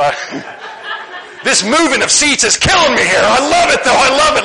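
high, loud laughing delight. Real, not acted. Pastor laughing at his wife moving seats, high and loud. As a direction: laugh it out, tickled by the seat shuffle.

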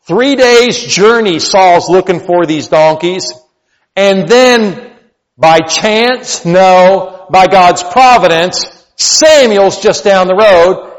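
loud, high, slow storytelling from the pulpit; full-force preaching. Acted. Pastor retelling Saul's donkey hunt to the congregation, booming preaching. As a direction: preach it out big to the room.

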